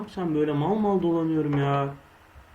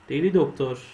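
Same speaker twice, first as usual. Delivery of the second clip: ordinary talk, nothing marked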